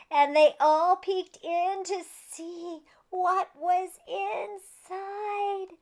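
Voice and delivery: plain reading voice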